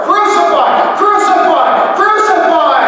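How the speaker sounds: very high, full-force repeated yell; mob shouting. A narrator's reading. Crowd keeps pressing Pilate for death, shouting. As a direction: scream it as a relentless chant. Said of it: "they shouted out more".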